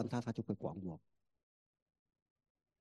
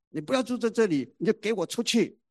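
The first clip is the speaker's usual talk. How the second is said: high, loud furious order to leave; angry mother shouting; 9 semitones higher, 8 dB louder than usual. Retold or imitated. Mother ordering her rebellious son out, angry shout. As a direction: throw him out of the house with a yell. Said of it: "何眠就说".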